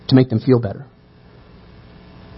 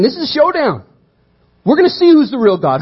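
excited challenge; 9 semitones higher than usual. Retold or imitated. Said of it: "Elijah's saying".

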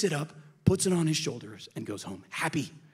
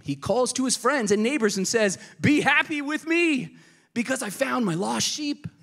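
joyful call; 9 semitones higher, 4 dB louder than usual. Acted. Reading joyful shepherd's call.

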